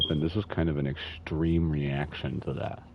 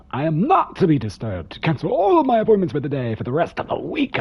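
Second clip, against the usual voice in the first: much higher, much louder mock director; pompous boss voice; 12 semitones higher, 10 dB louder than usual. Streamer imitating the director ordering Barbara, hammed-up and loud. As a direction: ham up a self-important boss giving orders. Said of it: "he totally wouldn't talk like that".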